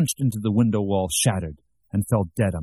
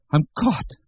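higher-pitched cry of being caught; alarmed realization; 7 semitones higher than usual. A narrator's reading. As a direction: let panic lift the voice. Panics at being found out.

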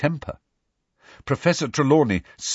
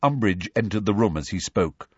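plain reading voice

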